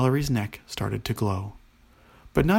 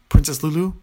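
raised, louder, courteous summons; graceful command; 4 semitones higher, 8 dB louder than usual. A narrator's reading. Princess calling on another princess to help, graceful command. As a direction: summon her with gracious authority. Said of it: "Nea commanded gracefully".

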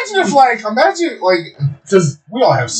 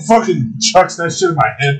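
normal talking voice

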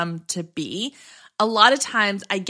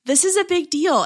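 excited; 7 semitones higher, 4 dB louder than usual. Real, not acted. Celebrating milestone.

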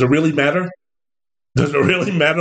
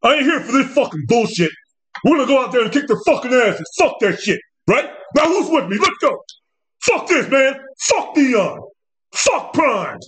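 hyped coach rant, a touch higher; mock tough coach voice; 6 semitones higher than usual. Coach firing up his team before kickoff, mock hype rant. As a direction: ham up a fired-up coach. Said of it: "They try to get that deep Negro voice".

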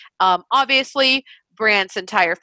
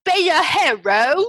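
switched accent, exaggerated and high; [name]; 6 semitones higher than usual. Co-host showing the actress's sudden accent switch, exaggerated accent. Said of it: "to go from that accent to that accent".

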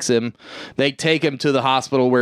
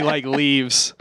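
ordinary talk

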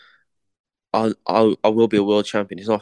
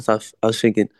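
ordinary delivery

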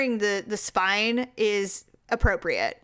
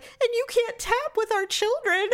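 high put-on voice of the husband; lightly voiced tender husband; imitating husband; 12 semitones higher than usual.

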